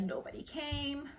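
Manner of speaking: high, soft, drawn-out little lament; mock forlorn. Presenter voicing shy ghost at empty concert, mock forlorn. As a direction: voice the let-down ghost, softly and slowly.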